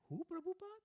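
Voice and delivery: very high, baffled question; puzzled. Retold or imitated. Scholar failing to understand the disciple's accent, baffled. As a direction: squeak out a confused query. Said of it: "he couldn't understand the accent".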